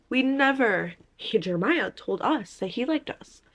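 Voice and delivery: ordinary delivery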